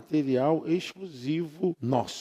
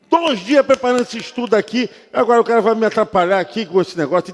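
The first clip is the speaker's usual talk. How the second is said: louder, higher, indignant retelling; annoyed complaint; 5 semitones higher, 6 dB louder, faster than usual. Retold or imitated. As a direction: vent the irritation.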